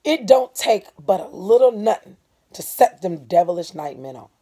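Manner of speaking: a touch of bitterness; bitter. Acted. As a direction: spit it out bitterly. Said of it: "then went on bitterly".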